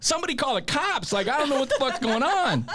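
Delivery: high, fast panicked babble. Retold or imitated. Robbed by a twelve-year-old, high panic. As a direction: flail in high-pitched panic.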